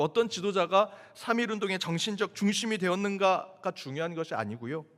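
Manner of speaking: ordinary talk, nothing marked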